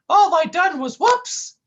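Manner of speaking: high, loud, slow goofy yelp. Acted. Sidekick tripping while talking, goofy high yelp. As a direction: stumble into it like a cartoon buffoon.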